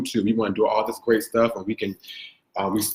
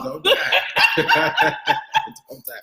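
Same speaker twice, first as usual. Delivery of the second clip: laughing; much slower than usual. Real, not acted. Teasing friend who choked.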